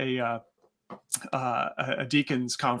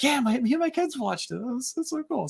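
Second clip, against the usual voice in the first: high excited fan; 9 semitones higher than usual. Retold or imitated. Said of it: "he was like".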